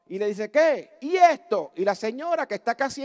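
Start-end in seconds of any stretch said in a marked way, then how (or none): 0.5-1.7 louder, raised challenge; retold heckling shout. Hunchbacked lady challenging the preacher, shouting. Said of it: "le empezó a gritar".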